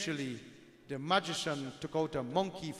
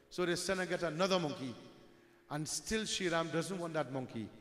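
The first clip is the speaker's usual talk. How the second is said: normal talking voice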